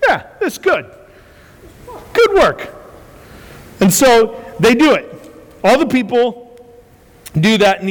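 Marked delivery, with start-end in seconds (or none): 0.1-2.7 high, eager crowd voice chiming in; a high, excited mock crowd chorus; eager crowd agreeing. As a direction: play the whole crowd nodding along, pitched up. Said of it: "Everyone's like".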